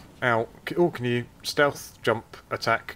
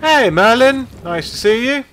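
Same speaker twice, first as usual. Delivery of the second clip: loud cheerful greeting; 13 semitones higher, 11 dB louder than usual. Real, not acted. Calling out to a viewer.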